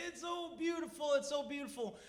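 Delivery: high-pitched mock wonder; overcome, awestruck buddy. Buddy staring at the sky, overcome with wonder. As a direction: marvel helplessly in a high voice.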